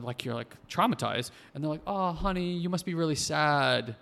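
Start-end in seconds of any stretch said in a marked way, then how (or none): 1.9-4.0 imitated parent fussing over a child; high-pitched mock sympathy, easing down and slowing; sympathetic parent. Parents voiced comforting traumatized child.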